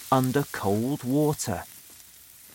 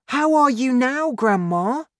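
put-on high, raised boy's question; boy checking on grandma; 11 semitones higher, 5 dB louder than usual. A narrator's reading. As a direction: ask eagerly in a high young voice.

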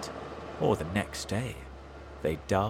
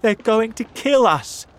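higher, louder frightened mother fox; 13 semitones higher, 11 dB louder than usual. A narrator's reading. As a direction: panic to her husband.

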